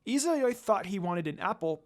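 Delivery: usual speaking voice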